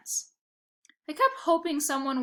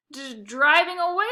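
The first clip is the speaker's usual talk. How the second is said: flustered stammer, higher and louder; stutter; 5 semitones higher, 7 dB louder than usual. Acted. Bag boy stammering to angry old lady, flustered stutter. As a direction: trip over the first word nervously. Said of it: "I stuttered".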